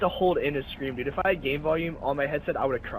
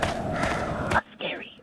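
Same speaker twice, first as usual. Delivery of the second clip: spooky whisper; much slower than usual. Pretend. Teasing friend during horror game.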